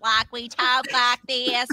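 slow, stretched mock Texas drawl; over-the-top Texas accent. Texan mocking over-the-top movie accents, exaggerated slow drawl. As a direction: drag it out like a bad movie Texan. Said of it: "everybody was so over the top".

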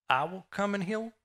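"I will come and heal?" lifted high into a question; incredulous question. Acted. Jesus asking the centurion back, questioning. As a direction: ask "I will come and heal?" with pitch climbing to the end. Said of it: "Jesus is asking him".

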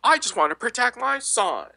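mock quote. Mocking the woman's message about her son, high and slow. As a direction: play her up as a whiny mother. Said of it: "And she's like".

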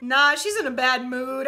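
ordinary talk, nothing marked

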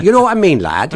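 playful Lancashire twang, pitched well up; Lancashire accent. Shown as a demonstration. Recalling the Lancashire accent he might have had, broad and high. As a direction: put on a broad Lancashire lad voice. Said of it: "quite attractive and acceptable Lancashire accent".

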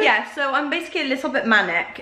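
usual speaking voice

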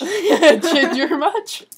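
slow teasing jab through laughter; laughing tease. Real, not acted. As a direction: drag it out, laughing. Teasing comeback while laughing.

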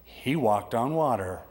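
slow, weighty statement; he walked on water, said slow and firm; slow emphatic. Shown as a demonstration. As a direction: let each word land slowly. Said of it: "just remember".

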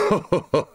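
short gloating chuckle; mocking laugh. Cop laughing that it's not him in trouble, mocking laugh. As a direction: laugh at someone else's bad luck.

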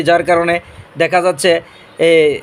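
usual speaking voice

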